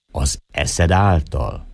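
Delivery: slow, drawn-out doubting question. Acted. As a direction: let each word hang slowly. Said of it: "mondta lassan". (lassan, elnyújtva kérdezve)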